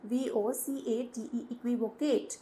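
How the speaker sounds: spelling slowly for the class. Shown as a demonstration. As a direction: dictate each letter for notes. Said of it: "एक synonym इसका होता है इक्विवोकेट".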